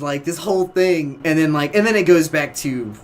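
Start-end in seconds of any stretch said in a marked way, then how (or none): none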